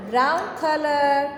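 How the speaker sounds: drawn-out teacher dictation; slow dictation. Shown as a demonstration. Teacher dictating colour name slowly to kids. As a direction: stretch each word slowly for the class. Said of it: "You have to fill".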